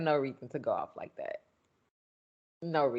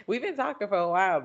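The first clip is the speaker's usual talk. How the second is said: laughing; 6 semitones higher, much faster than usual. Real, not acted. Laughs mid-sentence.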